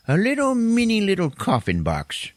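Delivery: squeaky-high, playful description; high tiny voice. Real, not acted. Podcaster describing a tiny coffin, in a high little voice.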